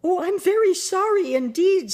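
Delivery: scared Dorothy apologising to the melting witch; frightened apology. A narrator's reading. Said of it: "truly frightened".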